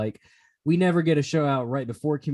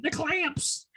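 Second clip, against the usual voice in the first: Clamps impression; 13 semitones higher, 5 dB quieter than usual.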